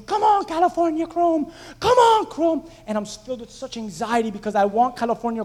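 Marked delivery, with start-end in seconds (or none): 0.0-2.7 high, loud cheering for the horse; screaming at the TV. Retold or imitated. Fan at home screaming at the TV for his horse, high loud cheering. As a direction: yell him home, urgent. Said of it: "and I'm screaming".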